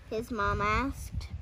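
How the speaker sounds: ordinary delivery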